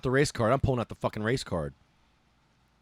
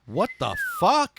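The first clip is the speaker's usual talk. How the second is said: high, slow and louder disbelief; stunned what the fuck; 11 semitones higher, 5 dB louder, slower than usual. Real, not acted. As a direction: let the outrage stretch out. Outrage at the bigot.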